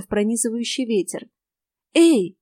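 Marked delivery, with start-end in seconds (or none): 1.9-2.4 sharp loud call to get his attention; loud hail to a shivering boy. A narrator's reading. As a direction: shout Эй across the clearing. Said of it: "окликнула я".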